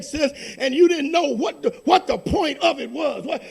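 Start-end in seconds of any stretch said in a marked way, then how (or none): none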